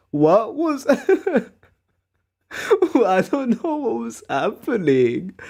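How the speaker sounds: high, laughing disbelief; embarrassed laughter at the memory. Real, not acted. Laughing at own past.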